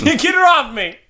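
high squeal broken by laughter; laughing mock panic. Player laughing as panicked man. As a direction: cry it out through giggles.

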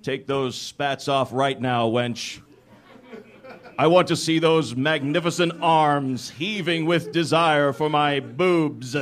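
low, slow commanding seducer; hammed-up lustful order, drawn out at the end; male lover role. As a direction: play the domineering romance hero.

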